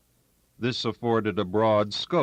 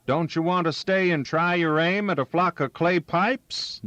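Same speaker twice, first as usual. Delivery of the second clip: higher, lightly raised call; shouted back; 8 semitones higher, 3 dB louder than usual. Acted. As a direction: toss it back over the noise. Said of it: "Jabber shouted back".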